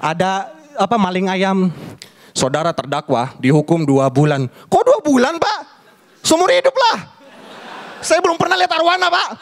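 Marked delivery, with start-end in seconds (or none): 2.4-4.5 judge voice. Retold or imitated. Judge passing sentence. 4.7-9.3 high pleading protest. Retold or imitated. Convict protesting to judge.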